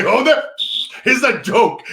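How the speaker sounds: husband telling his screaming wife it's only a prank. Retold or imitated. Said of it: "And I say".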